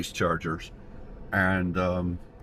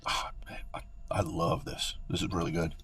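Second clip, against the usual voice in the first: whispering; 7 semitones lower, 11 dB quieter than usual. Real, not acted. Tasting food, whispering.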